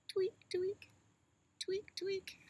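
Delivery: high, whispered little tweak noises; squeaky tweak sound. Acted. Baby elephant signalling mama with high tweaks. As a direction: tweak tweak like a tugged tail signal. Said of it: "tweak twice".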